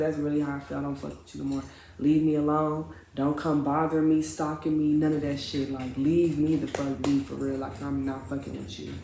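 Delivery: low, soft, final dismissal; firm, subdued rejection. Retold or imitated. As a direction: cut him off for good without raising your voice. Acted quiet break-up speech.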